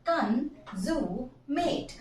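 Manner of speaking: "consummate" broken into three drawn-out pieces; "consummate" sounded out syllable by syllable. Shown as a demonstration. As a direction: stretch "consummate" into three slow chunks. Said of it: "मैंने इस word को तीन टुकड़े में तोड़ा".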